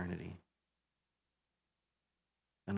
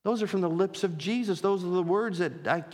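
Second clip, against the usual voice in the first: higher, raised pulpit voice; fervent preaching; 5 semitones higher, 4 dB louder than usual. Real, not acted. Pastor telling church all will give account, fervent preaching. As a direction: appeal earnestly to the whole congregation.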